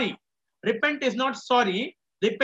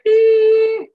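shrill high mock wail, much louder; mock crying wail; 10 semitones higher, 11 dB louder than usual. Shown as a demonstration. Preacher mocks childish crying for the congregation, shrill loud wail. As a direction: squeal out a fake cry to show the wrong kind. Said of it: "We are not crying like".

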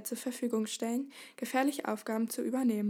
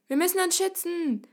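high, loud cry of a crowd; shouting; 8 semitones higher, 5 dB louder than usual. A narrator's reading. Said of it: "schrie das Volk". (schreiend, hoch und laut)

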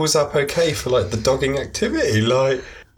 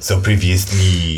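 low mock French accent; 5 semitones lower than usual. Co-host mocks the French forfeit, French accent. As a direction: begin a recap as a French narrator. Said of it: "you will do French".

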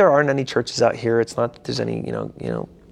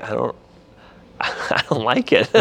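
giggling mild protest; sheepish laughing refusal to God; laughing sheepishly; 3 semitones higher than usual. Retold or imitated. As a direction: laugh through a half-hearted complaint. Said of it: "I was like".

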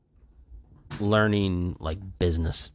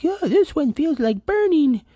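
much higher, whiny mock complaint; mock pained whine; 3 dB louder than usual. Telling listeners about his burnt thumb, a mock high whine. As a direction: whine about the burn in jest.

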